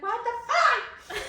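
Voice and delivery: high, slow, laughing disbelief; laughing what the fuck. Retold or imitated. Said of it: "and I was like".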